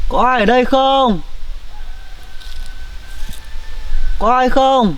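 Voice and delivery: high-pitched call carried into the woods. Acted. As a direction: call out to anyone nearby, stretched. Calling for the baby's parents.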